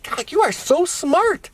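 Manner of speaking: high, slow, drawn-out admiration; amazed praise. Retold or imitated. Praising niece for her drapes advice, amazed. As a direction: gush at a clever kid, stretching the words.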